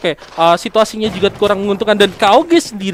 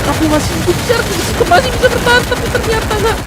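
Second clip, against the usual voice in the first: excited; 13 semitones higher than usual. Real, not acted. Caster calls near death.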